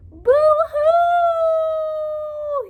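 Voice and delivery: boo-hoo sob. Acted. Beaver sobbing.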